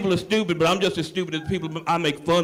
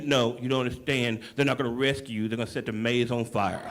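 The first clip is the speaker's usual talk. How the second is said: much lower and softer, flat deadpan delivery; a low, quiet operator breaking bad news; 8 semitones lower, 6 dB quieter than usual.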